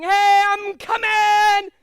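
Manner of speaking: slow, loud, drawn-out call; mock warning shout. Instructor acting out the back leg shouting a warning. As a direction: stretch it out like a playful warning.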